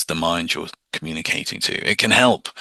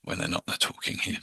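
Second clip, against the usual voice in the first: whisper; 2 semitones lower, 13 dB quieter than usual. Shown as a demonstration. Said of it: "my inner self".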